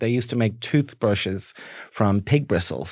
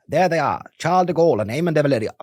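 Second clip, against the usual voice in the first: raised vintage newsreel commentator; old BBC voice; 4 semitones higher than usual. Acted. Recalling De Gaulle's Dublin visit, old BBC newsreel voice. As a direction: announce it like old BBC footage. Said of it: "those old B B C voices".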